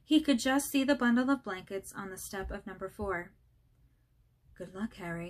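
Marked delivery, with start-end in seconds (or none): 4.5-5.3 soft, low farewell murmur. A narrator's reading. Dumbledore leaving baby Harry on the doorstep, soft murmur. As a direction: breathe it out gently to the baby. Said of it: "he murmured".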